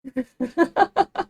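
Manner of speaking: loud, high giggle; high-pitched laugh. Real, not acted. Embarrassed by the failed note, a high loud giggle.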